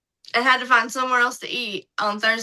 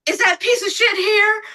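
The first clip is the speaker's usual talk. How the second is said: much higher, shouted, furious demand; angry shout; 9 semitones higher, 4 dB louder than usual. Retold or imitated. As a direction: bellow it across the room. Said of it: "And he was like".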